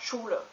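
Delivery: Schule said with a soft sh and long u; German Schule. Shown as a demonstration. Teaching a friend German, clear pronunciation. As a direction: say Schule as SHOO-leh. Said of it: "which is pronounced".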